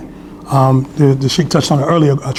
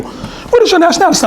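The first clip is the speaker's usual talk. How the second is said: imitating a British woman, very high; high-pitched mock English question; British accent; 13 semitones higher than usual. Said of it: "They had a British accent".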